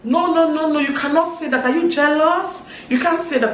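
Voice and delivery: high, loud indignant protest; heated quarrel. Retold or imitated. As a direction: re-enact an outraged shouting match. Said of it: "they just heard noise".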